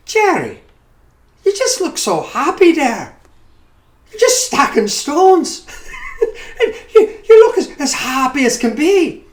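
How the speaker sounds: high, bright Irish brogue, laughing; lilting Irish neighbor woman's brogue. Retold or imitated. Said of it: "I sometimes utilize the brogue".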